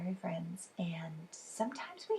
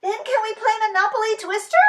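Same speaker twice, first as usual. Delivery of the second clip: fast, higher, excited; eager; 13 semitones higher, 9 dB louder, faster than usual. Acted. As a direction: rush the question. Child eager to play.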